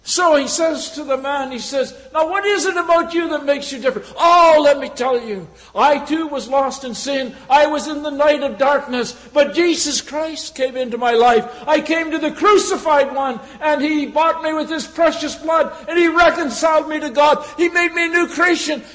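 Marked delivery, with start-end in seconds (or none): 2.1-4.1 fast, higher, loud astonished question; a stunned soldier shouting his question; amazed shout. Acted. Amazed soldier asks the believer. 4.2-18.8 loud, high preaching at full force; a believer shouting his testimony to the soldier; fervent testimony. Acted.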